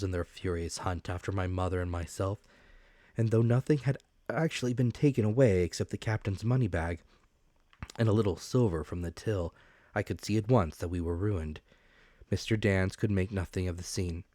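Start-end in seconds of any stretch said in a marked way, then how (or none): none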